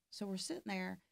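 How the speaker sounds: ordinary talk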